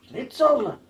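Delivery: high-pitched mock Austrian exclamation; Arnold Schwarzenegger accent. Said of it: "doing the Arnold impression".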